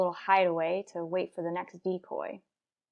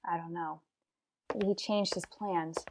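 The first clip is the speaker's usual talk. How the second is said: much softer, kept under the breath; hushed; 10 dB quieter than usual. Acted. Hiding detective answers his partner's question, hushed. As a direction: murmur it so nobody hears.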